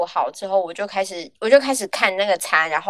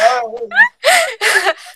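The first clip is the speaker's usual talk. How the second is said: high burst of loud laughter; 13 semitones higher, 6 dB louder than usual. Real, not acted. Laughing hard at the friend's crying joke. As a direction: let the laugh break out.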